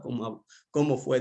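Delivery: ordinary delivery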